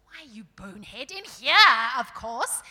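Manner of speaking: loud burst of impatience, jumping up in pitch; exasperated dwarf. Acted. Dwarf answering a silly question, exasperated. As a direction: snap at a fool, loudly.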